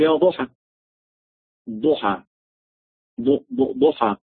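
"ضحى" with a heavy d, tongue held up on the palate; correct dad. Shown as a demonstration. Teacher drills the name for students, correct dad. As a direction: sound out "ضحى", repeating the first heavy syllable. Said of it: "اللسان بيعلق فوق".

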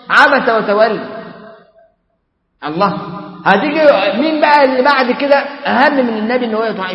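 ordinary talk

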